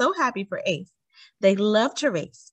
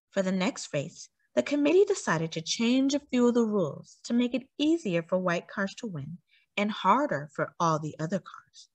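usual speaking voice

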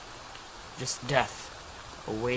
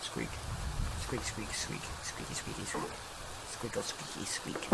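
soft, breathy mock squeak noises; whispered squeaks; 12 semitones lower, 22 dB quieter than usual. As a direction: play at being the creaking floor. Mimicking squeaky footsteps in game.

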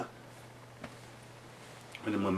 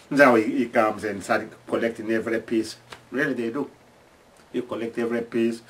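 usual speaking voice

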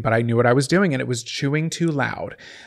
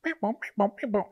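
cat meowing; 7 semitones higher, 6 dB quieter, faster than usual. Retold or imitated. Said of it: "you're like".

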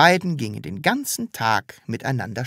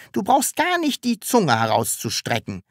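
higher-pitched, mild reprimand; stern; 8 semitones higher than usual. A narrator's reading. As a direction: tell the child off strictly. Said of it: "sagte Herr Taschenbier streng". (streng zurechtweisend)

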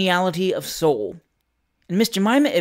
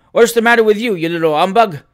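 loud, higher sneering retort; scornful retort; 4 semitones higher, 7 dB louder than usual. A narrator's reading. The whiskered man loudly mocks Samuel Wilkins. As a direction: talk down to the little humbug. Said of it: "replied the whiskers".

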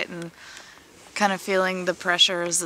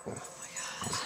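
whisper; 15 dB quieter than usual. Real, not acted. Annoyed at the dog's noise.

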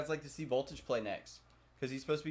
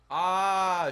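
startled cry; 7 semitones higher, 10 dB louder than usual. Real, not acted. Scare in game.

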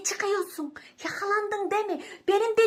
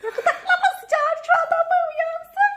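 being tickled; 11 semitones higher, 4 dB louder, slower than usual. Real, not acted. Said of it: "being tickled".

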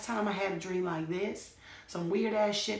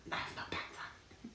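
whispered confession of having no pants on; 11 dB quieter than usual. Real, not acted. Whispering to viewers that she has no pants. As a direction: hush it like a secret.

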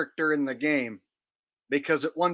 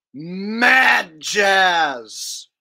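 mad Jazz bellowed big and high for effect; hyped-up announcement; 3 semitones higher, 7 dB louder than usual. Acted. Host teasing viewers before the next clip, with a loud hammy shout. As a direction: build it up like a wrestling intro. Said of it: "Now you're gonna see".

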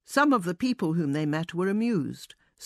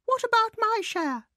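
very high mouse cry of protest. A narrator's reading. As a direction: demand it in a piping mouse cry. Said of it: "he cried".

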